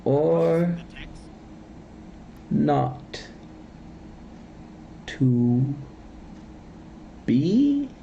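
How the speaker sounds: Or, not, to, be with a long pause after every word; long dramatic pauses. Retold or imitated. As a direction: stretch each word apart like searching your soul. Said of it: "pauses between every single word".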